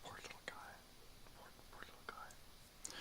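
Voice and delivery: soft whisper of sympathy; whispered pity. Real, not acted. Pitying the startled dog in a soft whisper. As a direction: barely breathe it out, pitying.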